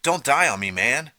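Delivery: high, loud desperate plea; desperate moan. A narrator's reading. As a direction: beg him not to die. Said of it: "Artie moaned".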